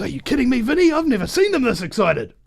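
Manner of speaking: high, loud imitation of a disbelieving mate; mock incredulous. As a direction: act out the mate's loud disbelief. Acts out mate's incredulous reply.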